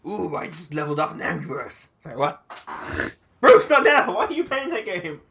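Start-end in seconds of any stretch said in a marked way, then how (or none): 3.4-5.2 sharp loud scolding, high; exasperated shout. Real, not acted. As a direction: snap at him to stop. Scolding a distracted co-player.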